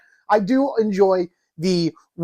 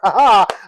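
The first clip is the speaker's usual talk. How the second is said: eerie ghostly laugh; 5 dB louder than usual. Pretend. Ends the Ghost Host spiel.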